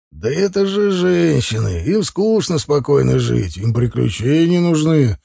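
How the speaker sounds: slow, lightly voiced older man, amused; chuckling it off; father-in-law shrugs off the women's quarrel; amused smirk. A narrator's reading. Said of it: "усмехнулся Загид".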